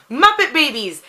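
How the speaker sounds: sudden happy memory; excited recall. Real, not acted. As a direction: blurt it out as it comes to you. Suddenly remembers a favorite show.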